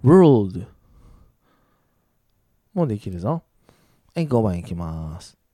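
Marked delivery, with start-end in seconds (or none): 0.0-0.7 loud "World" with a clear l; careful L sound. Shown as a demonstration. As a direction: punch out "World", minding the l before the d. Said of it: "Lの音に気をつけて".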